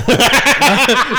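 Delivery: high loud laugh bursting out; burst of laughter. Real, not acted. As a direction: crack up loudly. Laughing at a crude joke.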